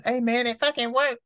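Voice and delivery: jokey high-pitched exclamation; high, put-on joke voice; out of own voice. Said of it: "went out of my voice just to go up there to make a joke".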